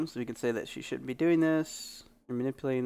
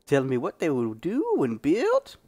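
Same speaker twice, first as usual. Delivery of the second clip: put-on high, grizzled old prospector; old man prospector voice; 11 semitones higher, 6 dB louder than usual. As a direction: play a crusty old prospector. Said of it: "doing a freaking old man prospector voice".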